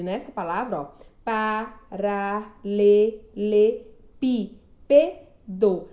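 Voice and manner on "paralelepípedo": "paralelepípedo" loud and slow as pa, ra, le, le, pí, pe, do; syllable by syllable. Shown as a demonstration. Teacher showing a long word, "paralelepípedo" syllable by syllable. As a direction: punch out all seven syllables of "paralelepípedo", pausing between. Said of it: "Sete sílabas".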